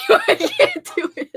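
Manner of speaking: high, slow, laughing; helpless real laughter. Real, not acted. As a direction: barely get it out for laughing. Collapsing in laughter.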